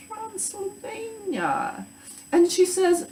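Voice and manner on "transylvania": slow, hushed, drawn-out Transylvanian accent. Shown as a demonstration. Teacher introducing a story character from Transylvania, spooky Transylvanian accent. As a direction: stretch it into a soft Transylvanian accent. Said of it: "inflection and accents when I read".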